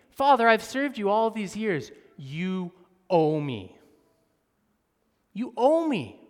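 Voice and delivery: loud, insistent grievance; you owe me, hammered home; resentful demand. Retold or imitated. Older brother demanding what he is owed from father, loud. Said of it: "He's saying".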